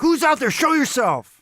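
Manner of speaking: high, loud challenge into the dark; confrontational shout. Acted. Player confronting whatever is out in the woods, shouted challenge. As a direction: demand that the lurker come out. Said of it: "verbally confronting whatever it is out there".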